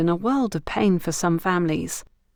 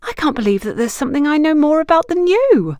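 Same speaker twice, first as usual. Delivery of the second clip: high, louder, astonished outburst; gasping in surprise; 9 semitones higher, 5 dB louder than usual. A narrator's reading. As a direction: she can hardly believe it, breathless. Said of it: "Antonia gasped".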